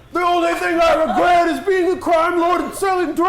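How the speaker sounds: high, loud last-words shout; dying shout. Acted. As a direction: yell it out while falling. Falling crime lord's last words.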